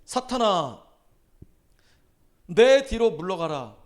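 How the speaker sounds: loud, slow commanding rebuke; stern rebuke. Acted. Jesus rebuking Peter, stern loud command. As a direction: drive him off with each word. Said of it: "꾸짖어 이르시되".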